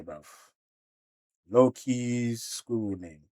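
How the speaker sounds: ordinary talk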